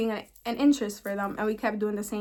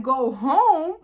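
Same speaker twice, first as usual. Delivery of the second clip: incredulous; 2 semitones higher, 6 dB louder than usual. Retold or imitated. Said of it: "I'm like".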